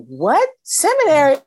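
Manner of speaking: loud, high startled what; shocked disbelief. Retold or imitated. Hearing the seminary suggestion, a shocked loud outburst. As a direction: recoil at the very idea. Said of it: "And I went".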